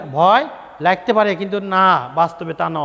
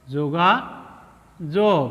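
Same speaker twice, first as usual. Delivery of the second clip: slow, syllable by syllable যোগাযোগ; teacher drawing out a word for pupils; drawn out slowly; 4 semitones lower, much slower than usual. Shown as a demonstration. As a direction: stretch যোগাযোগ out, one syllable at a time. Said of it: "ভেঙে ভেঙে বানান করতে হবে।".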